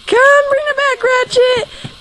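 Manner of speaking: high sing-song call to the dog. Real, not acted. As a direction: call him back way up high. Calling the dog back.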